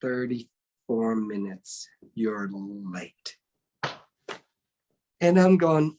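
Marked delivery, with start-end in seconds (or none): 0.0-3.4 slow, low deadpan verdict; mock-stern sifu; stern master. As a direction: drop low and slow like a stern teacher delivering the time. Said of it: "He says".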